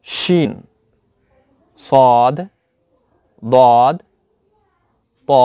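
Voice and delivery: each Arabic letter name called out slowly with long pauses, for the class to repeat; Arabic letter recital. Shown as a demonstration. Teacher to students, demonstrating the Arabic alphabet for repetition. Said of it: "আমার সাথে সাথে আপনারা বলবেন".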